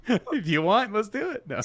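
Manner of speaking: high, amused laughing voice; talking through laughter. Real, not acted. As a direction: say it while cracking up.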